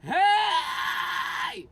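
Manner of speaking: loud high held scream. Shown as a demonstration. Demoing his high scream for the viewer. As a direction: push it into a full scream. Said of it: "So, hey!".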